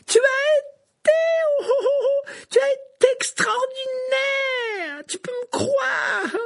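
very high, slow tearful shriek; piercing cry. A narrator's reading. Hermione shrieking her relief at Harry, piercing. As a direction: shriek with relief near tears. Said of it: "d'une voix perçante".

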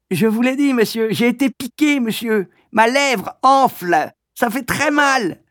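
stung schoolboy in pain; swollen-lip boy. Acted. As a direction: drag out the hurt.

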